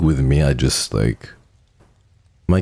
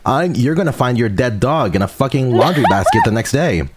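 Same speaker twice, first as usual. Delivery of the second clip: usual speaking voice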